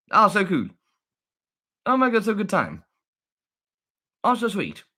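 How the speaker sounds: high, thrilled cooing; delighted gushing. Real, not acted. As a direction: marvel adoringly in a high voice. Gushing over monkey video.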